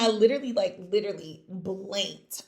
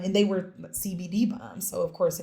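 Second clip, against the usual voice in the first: ordinary talk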